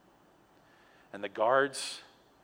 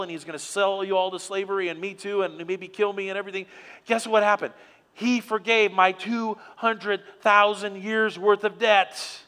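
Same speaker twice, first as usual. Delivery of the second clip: higher and louder, bursting with good news; excited husband on the phone; 6 semitones higher, 6 dB louder than usual. Acted. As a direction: call the wife all worked up. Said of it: "He says".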